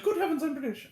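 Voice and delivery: mock posh British gasp, high; hammed-up British exclamation; imitating Kenneth Branagh. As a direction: quote the fainting Brit in jest. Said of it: "he's like".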